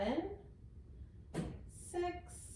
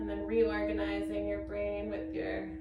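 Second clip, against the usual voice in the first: guided meditation; 5 semitones higher than usual. Acted.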